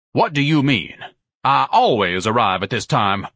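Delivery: indignant, self-important grandee; haughty, emphatic role voice; pompous Ignatius. Acted. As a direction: answer with pompous affront.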